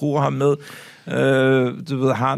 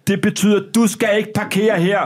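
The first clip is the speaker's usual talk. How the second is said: raised, higher-pitched stern telling-off; retold angry shouting; 6 semitones higher, 4 dB louder than usual. Biker telling off the man who parked there, mock angry raised voice. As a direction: lay down the law as an imitated biker. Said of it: "Står og råber mig ind i ansigtet".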